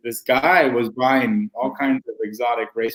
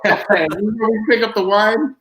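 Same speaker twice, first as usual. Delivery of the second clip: mock bird voice; 9 semitones higher, 4 dB louder than usual.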